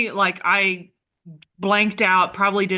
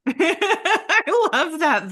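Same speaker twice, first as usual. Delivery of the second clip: laughing; 11 semitones higher, 4 dB louder, much slower than usual. Real, not acted. Host laughs delightedly.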